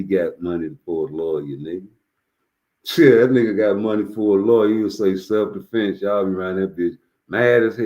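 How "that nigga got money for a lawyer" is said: normal talking voice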